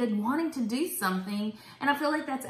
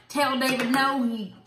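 half-joking Southern twang, a little higher and louder; southern accent; 5 semitones higher, 5 dB louder than usual. Retold or imitated. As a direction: slip into a light Southern accent for God. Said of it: "I don't know why God has that accent".

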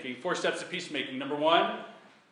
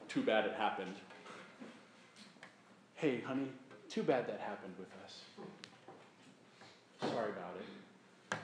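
softer, shrugging non-apology; dismissive apology; 5 semitones lower, 9 dB quieter than usual. Shown as a demonstration. Husband brushing off a fight with his wife, dismissive. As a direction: toss it off as if it barely matters. Mocking a shallow apology to spouse.

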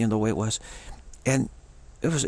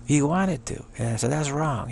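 normal talking voice

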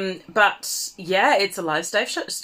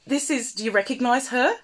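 normal talking voice

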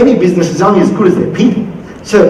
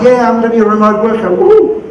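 fast, thrilled, ending in a much higher woo; so excited; 4 semitones higher than usual. Retold or imitated. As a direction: cheer it like a new remote worker. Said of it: "you're so excited".

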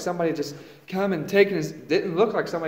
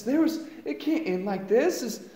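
usual speaking voice

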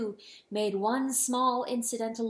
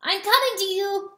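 high, raised call across the dark; shouted; 10 semitones higher, 5 dB louder than usual. A narrator's reading. Rooster calling to the lost hen, shouted. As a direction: call out loudly to reach someone. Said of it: "he shouted".

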